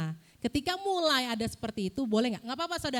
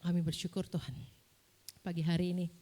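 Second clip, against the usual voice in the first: low-pitched, even praying voice; subdued, reverent address to God; prayer; 6 semitones lower than usual. Real, not acted. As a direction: lead the congregation gently in prayer. Leads closing prayer.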